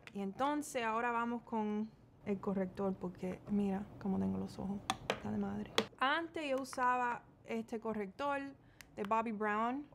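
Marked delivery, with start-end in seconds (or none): 2.9-5.7 whispered aside. Real, not acted. Telling viewers about her under-eye circles, whispered. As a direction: confide it under your breath.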